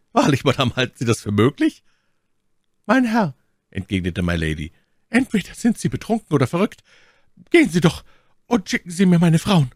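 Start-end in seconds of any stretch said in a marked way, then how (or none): 0.1-1.8 raised, mildly scornful question; mock the very idea; contemptuous. A narrator's reading. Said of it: "versetzte Lord Winter verächtlich". 2.8-3.3 high, forceful rebuke; indignant. A narrator's reading. Milady protests to the baron. 5.1-9.7 high, loud indignant command; angry. A narrator's reading. Milady in acted quarrel, orders him out.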